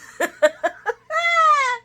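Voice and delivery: loud high-pitched laugh. Real, not acted. Laughing after voicing Lucy's voicemail, loud and high. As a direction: laugh at your own bit.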